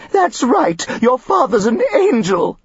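hammed-up sniffling Mrs Elliot; sniffing mother. A narrator's reading. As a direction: gush it through sniffles. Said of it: "Missus Elliot sniffed".

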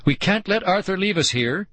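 high-pitched urgent appeal; frantic. A narrator's reading. Girl fears being left behind, frantically. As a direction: insist with rising panic. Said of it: "Nicole cried frantically".

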